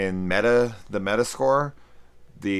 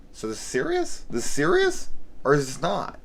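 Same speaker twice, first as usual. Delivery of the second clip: incredulous viewer; 8 semitones higher, 3 dB louder than usual. Retold or imitated. Mocking confused viewer reaction.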